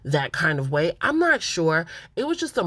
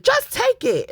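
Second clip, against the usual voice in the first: very high, loud squealed imitation; mock shriek; 5 dB louder than usual. Imitating Chelsea cutting off his apology, mock shriek. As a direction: squeal it as an outburst at a boyfriend.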